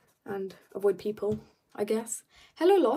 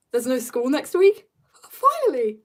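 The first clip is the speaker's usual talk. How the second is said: higher, louder happy cry; excited; 4 semitones higher, 5 dB louder than usual. Pretend. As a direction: cheer with delight. Own excited reaction, acted.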